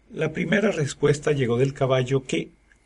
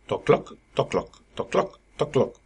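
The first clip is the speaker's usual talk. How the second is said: hollow, rhythmic clip-clop; imitated hoofbeats; 2 semitones higher than usual. A narrator's reading. Horse crossing onto cobbled path, clip-clop imitation. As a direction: imitate horse hooves on stone, evenly spaced. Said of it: "nuevo sonido hecho por los cascos".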